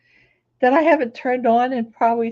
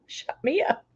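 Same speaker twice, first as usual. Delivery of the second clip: "shut me up" whispered, no voice; whispered echo; 6 dB quieter than usual. Shown as a demonstration. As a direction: breathe the words "shut me up" as a hushed aside. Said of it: "The shut-in has shut me up".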